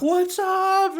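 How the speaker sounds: squeaky high greeting; high mock greeting. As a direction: chirp it up high like a goofy tourist. Quoting TikToker at parade.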